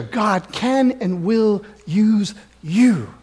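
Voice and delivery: normal talking voice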